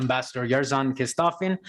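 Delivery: ordinary talk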